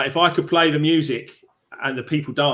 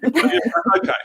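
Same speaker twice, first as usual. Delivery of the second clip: laughing okay; 13 semitones higher, 4 dB louder than usual. Real, not acted. Laughs at guest's remark.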